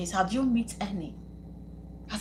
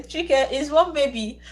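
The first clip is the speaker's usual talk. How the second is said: usual speaking voice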